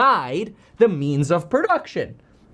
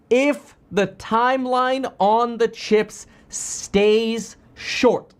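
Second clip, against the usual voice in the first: each word of "If the timeline on the chips stays short" drawn out slowly; slow emphatic reread; 3 semitones higher, much slower than usual. Shown as a demonstration. Streamer rereads the CFO's words for viewers, slow and deliberate. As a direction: read it back slowly so every word lands. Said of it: "If you actually listen to that closely".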